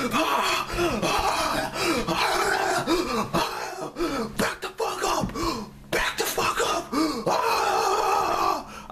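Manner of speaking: high-pitched, breathy strangled mock screams; frenzied half-voiced shrieking; ape-shit screaming. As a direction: reenact going berserk without full volume. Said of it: "I go fucking ape shit".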